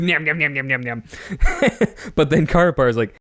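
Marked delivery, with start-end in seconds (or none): 0.0-2.1 slow greedy nyam nyam, higher at first; mock eating noises. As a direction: gobble it up like a hungry monster. Villain mocks eating the Matoran.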